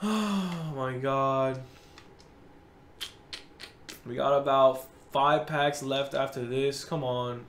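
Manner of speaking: low, soft, drained voice; quiet resignation. Real, not acted. Gamer with five packs left, quiet resignation. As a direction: keep it low and beaten. Resigned after vowing silence.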